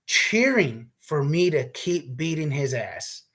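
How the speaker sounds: ordinary delivery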